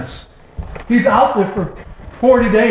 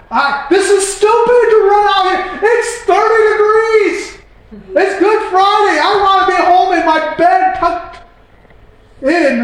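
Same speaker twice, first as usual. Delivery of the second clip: whining complaint; 10 semitones higher than usual. Retold or imitated. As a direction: complain to the Lord about the cold. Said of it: "I'm like".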